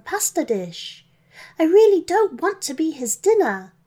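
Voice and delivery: put-on high, girlish plea for rescue; pleading captive girl. Captive girl tells Santa of the dragon, high pleading voice.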